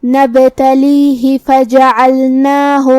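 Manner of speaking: نبتلیہ فجعلناہ chanted slowly, short "hi" ending the first word; short final ha. Shown as a demonstration. Teacher showing the unstretched ha, measured slow chant. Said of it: "اپنی آواز لمبی نہیں کریں گے".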